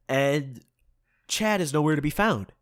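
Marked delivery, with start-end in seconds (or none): none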